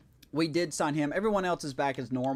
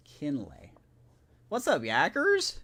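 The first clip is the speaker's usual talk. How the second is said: goofy voice; slower than usual. Pretend. Mock greeting in funny voice.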